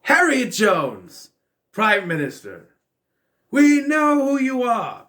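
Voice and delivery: drawn-out, high, loud call-out; loud exclamation of recognition. Retold or imitated. Reacting to seeing the mom in the film, loud exclamation. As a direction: blare it out slowly as you recognise who it is. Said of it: "and I went".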